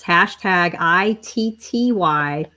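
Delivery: careful, clear dictation of each letter; repeating the hashtag spelling slowly for viewers. Shown as a demonstration. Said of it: "I'm gonna say it again".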